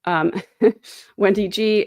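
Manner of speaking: usual speaking voice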